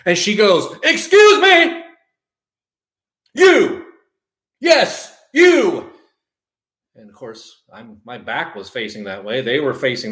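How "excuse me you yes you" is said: high, loud, stretched-out shriek; imitated scream. Upset lady calling out a waitress, imitated scream. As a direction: imitate an upset customer yelling across the room. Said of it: "somebody screams".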